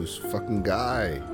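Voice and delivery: ordinary talk, nothing marked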